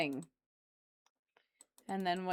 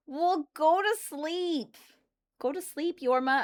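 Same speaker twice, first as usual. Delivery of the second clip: higher, slow sing-song; higher playful scolding of a sleepy viewer; teasing; 9 semitones higher, 5 dB louder, slower than usual. Real, not acted. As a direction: tease them off to bed.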